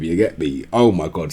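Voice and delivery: usual speaking voice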